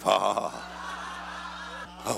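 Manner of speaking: low, spaced-out ha-ha; deliberate laugh. Shown as a demonstration. Preacher urges congregation to laugh at the devil, deliberate laugh. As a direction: laugh on purpose in separate beats. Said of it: "Just go ahead and laugh".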